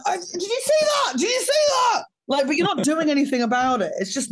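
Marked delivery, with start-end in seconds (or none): 0.1-2.0 high, shouted mock rage; mock dad yell. Mocking British dads yelling at their kids. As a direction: bellow it at the kids. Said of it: "aimed at your children".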